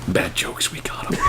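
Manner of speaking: unvoiced whisper with a giggle; hushed joking whisper; whispered aside. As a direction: breathe it out as a secret. Whispered outro tag.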